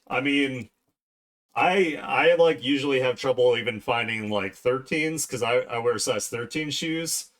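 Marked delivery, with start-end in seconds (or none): none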